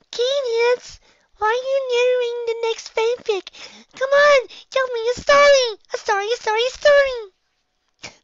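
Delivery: put-on very high, loud childish pleading; whiny filly Twilight. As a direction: pester him like an impatient little filly. Reader voices pestering Twilight character.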